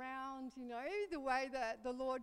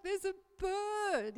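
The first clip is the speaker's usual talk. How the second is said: panicked; 7 semitones higher, 4 dB louder, slower than usual. Retold or imitated. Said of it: "there's panic in my voice".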